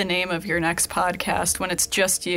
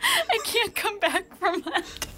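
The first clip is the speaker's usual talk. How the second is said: high, slow, squeaky through laughter; helpless laughter; 12 semitones higher than usual. Real, not acted. Co-host derailed by Cody's hello, helpless laughter. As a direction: try to talk while cracking up.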